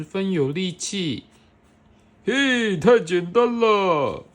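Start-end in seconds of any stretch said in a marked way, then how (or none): 2.2-4.2 gorilla voice. Acted.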